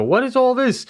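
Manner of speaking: retold outburst of frustration; frustrated. Patient handed a paper by the dentist's staff, frustrated. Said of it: "I'm just, I'm frustrated".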